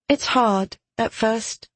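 put-on calm girl. A narrator's reading. Girl advising the soldier on the voice, calm. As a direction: explain calmly, a little high. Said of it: "Her face calmer than".

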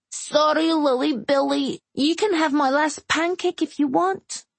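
put-on high, sweet child voice; little girl voice. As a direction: play a small girl making up.

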